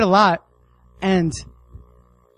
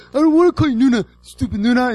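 higher, louder bratty whine; rebellious kid; 6 semitones higher, 4 dB louder than usual. Retold or imitated. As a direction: sulk like a defiant little brother. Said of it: "I was like".

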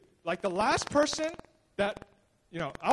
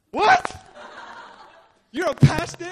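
loud, high disbelieving "What?" with a laugh; shocked; 7 semitones higher, 7 dB louder, slower than usual. Retold or imitated. Old friends stunned that Paul is a pastor, shocked. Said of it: "They were just so shocked".

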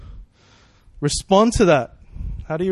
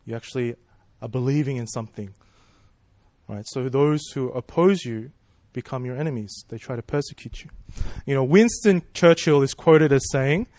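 ordinary delivery

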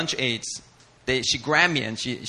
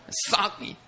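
usual speaking voice

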